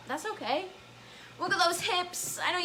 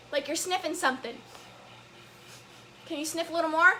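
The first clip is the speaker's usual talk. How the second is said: usual speaking voice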